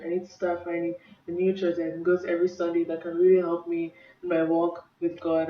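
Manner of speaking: ordinary delivery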